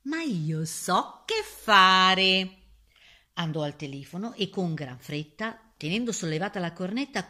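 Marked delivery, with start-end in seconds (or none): 0.0-2.6 very slow cry, loud at the end; pig crying out. A narrator's reading. Pig announces he knows what to do, crying out. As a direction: stretch the words, swelling on the last. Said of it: "gridò il porcello".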